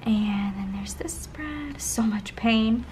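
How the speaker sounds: hushed, slow reveal; slow dramatic whisper. Real, not acted. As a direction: whisper it slowly like a secret. Dramatic pause before a spread.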